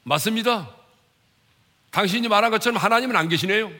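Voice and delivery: hammed-up agreement, high and loud; mock agreement. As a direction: play someone quickly chiming in. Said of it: "맞장구를 쳤습니까?".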